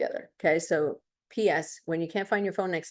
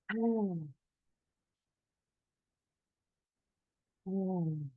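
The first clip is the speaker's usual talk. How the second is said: soft release; 8 dB quieter than usual. Shown as a demonstration. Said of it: "out through the mouth".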